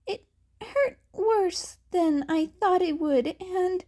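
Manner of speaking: put-on high, strained, teary boy's voice; nervous teen boy. Izuku thanking his teachers while fighting back tears. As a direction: play the hurt kid fumbling his thanks.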